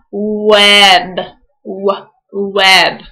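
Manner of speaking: "Web" stretched to hear the first w sound. Shown as a demonstration. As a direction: break "W-, web" apart on the w sound. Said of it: "Say it slowly so you can hear the sounds".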